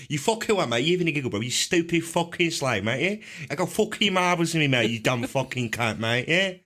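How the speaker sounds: exaggerated chav street accent. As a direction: rant like an English chav, dragging the insults. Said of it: "the Chav accent".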